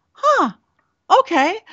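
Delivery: very high, startled huh; relieved that her client loves it, squeaky high; surprised squeak. Retold or imitated. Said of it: "I, I'm like".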